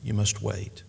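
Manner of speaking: soft, low, tender reply; quiet and fatherly, easing off softly; gentle master. Acted. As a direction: gently break it to a child, hushed. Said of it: "the master so gently said".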